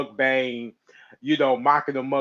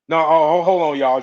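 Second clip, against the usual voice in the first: surprised; 3 semitones higher, 9 dB louder than usual. Real, not acted. Sudden interruption.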